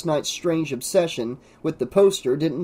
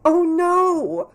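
put-on very high distressed girl's cry; high, drawn-out wail of dismay; crying out, near tears; 3 dB louder than usual. A narrator's reading. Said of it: "cried Carrie. She was close to tears".

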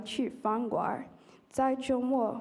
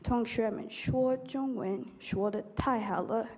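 ordinary talk

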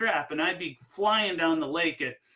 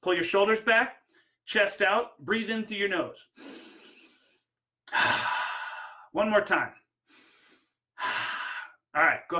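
raised, firm posture orders; Tony Robbins command; 4 semitones higher than usual. Retold or imitated. Coach ordering client's posture and breathing, firm and raised. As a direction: drill each instruction, pitched up and punchy.

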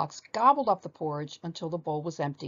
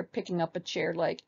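ordinary delivery, nothing marked

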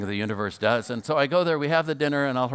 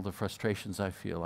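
soft echo; 3 semitones lower, 7 dB quieter than usual. Retold or imitated. Fred echoes, softer.

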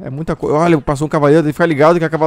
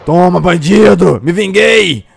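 loud, slow, higher victory yell; triumphant revenge shout; 4 semitones higher, 9 dB louder, much slower than usual. Real, not acted. Gamer avenging his death, triumphant loud yell. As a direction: crow over the kill.

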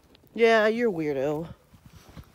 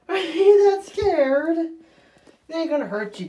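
soothing; 7 semitones higher, 3 dB louder, much slower than usual. Real, not acted. Dog frightened of bath.

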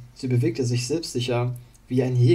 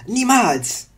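high, loud defiant cry; defiant shout; 11 semitones higher, 8 dB louder than usual. Acted. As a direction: roar the refusal. Wandel defies Illidan. (trotziger Schrei)

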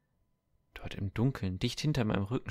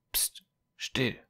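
hushed command; 4 semitones higher than usual. Acted. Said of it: "befahl er".

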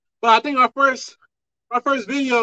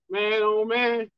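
normal talking voice

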